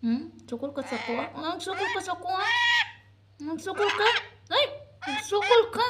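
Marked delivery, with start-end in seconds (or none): 1.4-2.5 high, quick, playful challenge; mock indignation. Mock scolding the baby. 3.4-5.9 high, loud, playful challenge; mock indignation. Mock scolding the baby.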